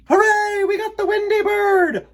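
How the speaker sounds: very high, loud cheering; triumphant cheer. Acted. Lost boys cheer downing Wendy, triumphant. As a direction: celebrate the hit. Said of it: "the lost boys shouted".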